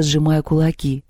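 plain reading voice

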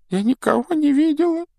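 put-on high, mournful whiny ghost girl; nasal whine. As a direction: whine it dejectedly. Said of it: "сокрушённо ответила Миртл".

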